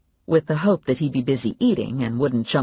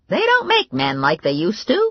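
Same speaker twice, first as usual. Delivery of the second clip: Grandma voice; 5 semitones higher, 4 dB louder than usual. A narrator's reading. Grandma remark.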